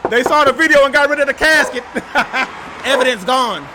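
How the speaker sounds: loud, high, gleeful disbelief; excited shout at the missing casket. Real, not acted. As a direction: yell it out as if you caught them. Excited discovery casket removed.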